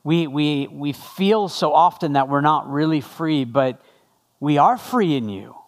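low, measured prayer voice. Real, not acted. Pastor praying to God for the congregation, low and earnest prayer. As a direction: pray in a subdued, conversational low voice.

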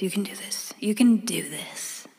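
whispering to herself for courage; whispered pep talk. Real, not acted. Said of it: "fearless in the face of making an intimidating phone call".

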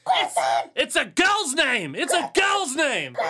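high, loud, drawn-out yell; hammed-up shouted reveal; mock outraged shout. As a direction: holler it slowly at the top of your voice. Yells out reaction to name reveal.

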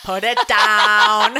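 loud, slow mock command; scolding breathalyzer. Phone breathalyzer scolding a drunk texter, hammed-up. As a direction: stretch it out as a gadget's stern order.

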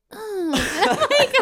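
high, slow, laughing through the words. Real, not acted. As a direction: crack up while talking.

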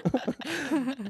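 high burst of laughter. Real, not acted. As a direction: break into laughter. Cohost laughs at mail.

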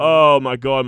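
loud retold cry of dismay; mock agony. Customer reacting as the barber shakes while cutting, mock agony. Suffering a rough haircut, retold.

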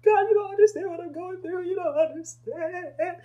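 high, whiny protest, slowing at the end; complaining believer. Pretend. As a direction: moan at God that nobody gets it. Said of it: "So that we can never truly say".

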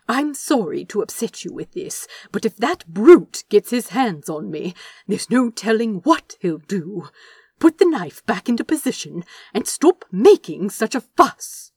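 loud urgent reasoning; urgent insisting. Acted. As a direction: scold him into line, urgently. Angel argues, urgent.

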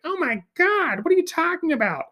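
very high-pitched, dismayed mother voice; imitated alarmed mom. Mom hearing about the grill, imitated alarm. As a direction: put on a high, aghast mom.

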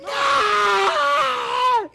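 very loud, high-pitched long scream; imitated scream. Aunts seeing the open umbrella, screaming. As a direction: scream like a horrified aunt. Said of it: "grita, así como de".

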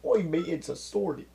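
high, loud mock-British voice; horrible British accent. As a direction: yelp it like a hammed-up Brit. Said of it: "a horrible British accent".

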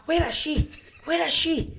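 high, urgent mock demand; high impatient demand. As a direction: pester for the barmaid. Impatient friend imitated.

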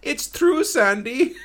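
high, loud imitated German accent; squeaky German accent quote. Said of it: "hear him in the background twice go".

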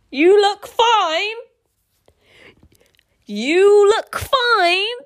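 loud, high, drawn-out disbelief; high, loud and slow, questioning every word; incredulous. Real, not acted. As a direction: stretch each word upward in outraged disbelief. Reader incredulously repeats the line just read.

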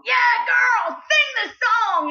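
high yelling cheer; imitating a cheering crowd yell; mock yell. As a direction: holler like a rowdy crowd. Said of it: "people yelling at me".

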